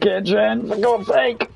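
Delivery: high, exasperated outburst. Real, not acted. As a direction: yell it in frustration. Exasperated at teammates.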